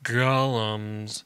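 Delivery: golems held out long; drawn-out reveal. Shown as a demonstration. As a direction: stretch out golems for the crowd. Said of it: "say it with me".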